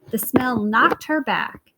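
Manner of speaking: plain reading voice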